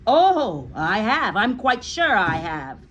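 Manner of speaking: loud smug answer; smug, grinning reply. A narrator's reading. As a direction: relish the cruelty. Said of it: "the Trunchbull answered, grinning".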